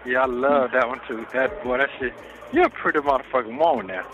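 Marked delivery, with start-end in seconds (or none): none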